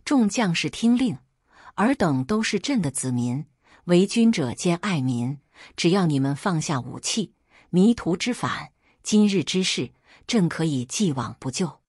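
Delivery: ordinary delivery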